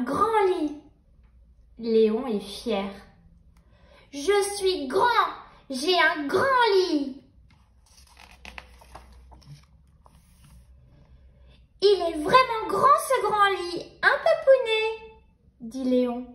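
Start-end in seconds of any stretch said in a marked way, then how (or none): 4.1-15.2 put-on little boy voice. Léon speaking proudly.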